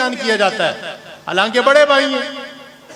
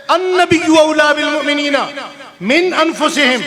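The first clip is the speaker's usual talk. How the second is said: recited Arabic verse, raised and chanted; Quranic recitation; 6 semitones higher than usual. Acted. Preacher intones the Quran verse to the audience, chanted.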